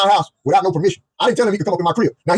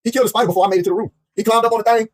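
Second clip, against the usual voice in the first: ordinary delivery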